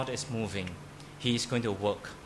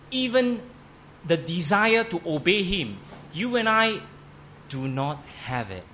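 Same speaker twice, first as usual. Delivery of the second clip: emphatic preaching; 4 semitones higher than usual. Real, not acted. Pastor stressing to congregation they lack desire, emphatic. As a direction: preach it with force. Pastor driving home the point.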